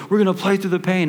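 a hint of a pushy coach rallying players. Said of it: "your coach was like".

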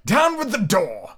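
high, drawn-out command; crying out. A narrator's reading. Blind beggar ordering his men in, crying out. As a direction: call the order out high and slow. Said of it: "he cried".

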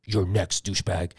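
low, lightly voiced robot threat; robot villain. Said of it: "then the robot's like".

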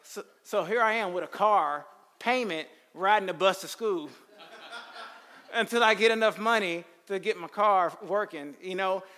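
laughing while telling. Real, not acted. Laughing at own misfortune.

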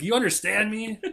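high, demanding parental question; retold pissed-off dad. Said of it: "I was pissed".

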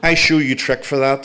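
put-on loud, upbeat Chinese accent. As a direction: announce the trick in a Chinese accent. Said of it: "with a heavy Chinese accent".

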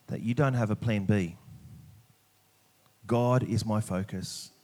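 normal talking voice

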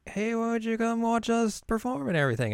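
put-on high, bright anime girl invite; sweet girly voice. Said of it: "And Ayumi's like".

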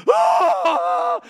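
high, loud imitated wailing scream; mock wail. Father imitating his daughter's fear on the free fall, loud wail. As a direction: wail like a terrified child mid-drop. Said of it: "this wail come out of her throat".

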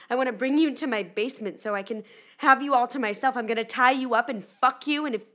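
building from loud to yelling threats; escalating fan threat. Retold or imitated. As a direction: let the fan letter tip into shouted rage. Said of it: "he started off being like".

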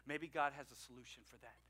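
normal talking voice

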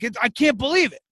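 a high-pitched father proud of his Alexa; old dad voice. Retold or imitated. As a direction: play your dad marvelling at the gadget. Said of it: "And he's like".

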